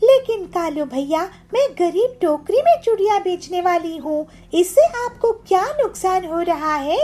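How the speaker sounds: put-on high, pleading young woman; pleading poor girl. Poor bangle seller pleads with Kalu, high and meek. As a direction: beg him meekly in a high voice.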